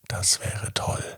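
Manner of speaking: dreamy hushed whisper. A narrator's reading. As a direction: breathe it like a secret. Boy's secret wish.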